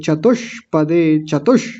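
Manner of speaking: चतुष्पदे slow, sh sustained; clear retroflex sh. Shown as a demonstration. Teacher stressing a sound often missed, चतुष with clear retroflex sh. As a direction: hold the sh in चतुष before moving on. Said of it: "मूर्धन्य शकार की आवाज़ स्पष्ट".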